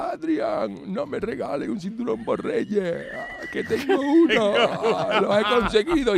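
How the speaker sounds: high, slow laughing cry of victory; hammed-up champion calling to his wife; triumphant yell. Rocky calling Adrian with his belt, laughing triumphant yell.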